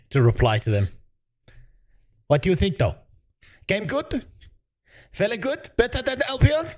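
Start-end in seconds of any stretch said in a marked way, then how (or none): 2.3-6.7 high, thin put-on Chinese accent in broken English; mock Chinese accent.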